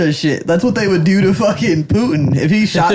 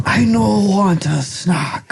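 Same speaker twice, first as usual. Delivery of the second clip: mock broken English; slower than usual.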